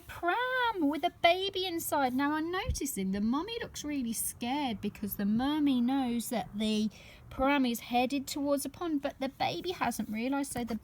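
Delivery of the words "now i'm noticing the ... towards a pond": ordinary delivery, nothing marked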